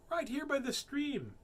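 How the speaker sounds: squeaky tiny mouse; high mouse voice. Acted. Mouse character talking.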